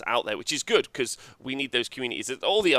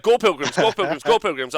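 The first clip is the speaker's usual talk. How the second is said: mock hype; 6 semitones higher, 6 dB louder than usual. Mocking podcasters chanting army name.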